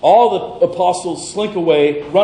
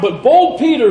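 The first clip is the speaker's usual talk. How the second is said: normal talking voice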